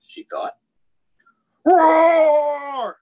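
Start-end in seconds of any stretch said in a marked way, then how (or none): 1.6-3.0 big high far-out lion roar. Acted. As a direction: let out a huge playful roar. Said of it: "she said to the lion".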